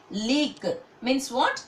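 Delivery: slow it right down, rising at the end on what; drawn-out teacher's question. Shown as a demonstration. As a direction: ask it slowly and let the question hang. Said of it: "See the meaning?".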